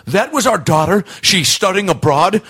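hammed-up outraged father; imitating angry dad. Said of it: "Dad loses his fucking mind".

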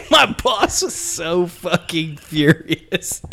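said through laughter; laughing. Real, not acted. Storyteller cracking up while recalling the boss. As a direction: laugh your way through the line.

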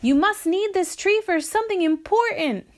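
called-out remark, pitched well up; calling down. A narrator's reading. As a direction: project down to people below. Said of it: "Walter called down".

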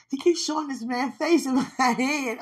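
giggling through the words; tickled, half-laughing confession. Real, not acted. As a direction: laugh it out in embarrassed delight. Giggling about the man's face.

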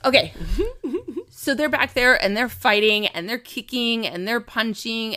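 usual speaking voice

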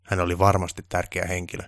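ordinary delivery

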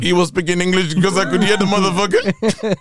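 loud high laughing shout. Real, not acted. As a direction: blurt it out through laughter.

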